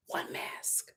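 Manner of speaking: hushed, breathy aside; whisper. Real, not acted. Vlogger whispering to viewers about the missing masks. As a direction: whisper it like a secret. Repeating point for emphasis.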